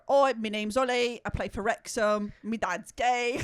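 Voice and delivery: loud put-on British introduction; mock British accent. Imitating Ollie introducing himself to people, mock British accent. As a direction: announce it with a teasing British accent. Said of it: "he introduces himself to people".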